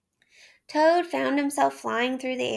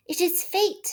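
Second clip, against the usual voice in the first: higher, musing Toad; Toad to himself; 7 semitones higher than usual. A narrator's reading. Toad talking himself into driving. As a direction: give in to temptation.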